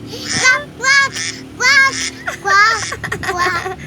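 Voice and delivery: put-on loud, high toddler quacking; duck quacks. Child imitates a duck.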